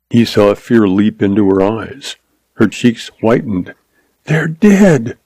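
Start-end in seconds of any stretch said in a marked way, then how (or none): none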